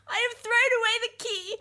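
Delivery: high, tearful and broken; weeping. Acted. Danu to Daksha, locked to the cart, weeping. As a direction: cry through the words. She weeps while trapping him.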